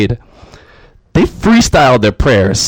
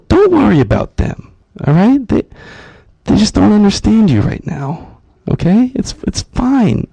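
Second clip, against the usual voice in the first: soothing a bird; 7 semitones higher than usual. Acted. Comedian consoling a lonely bird, high soothing coo. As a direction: reassure it like a sad little friend.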